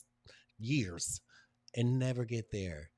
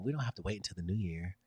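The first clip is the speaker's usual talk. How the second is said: ordinary talk, nothing marked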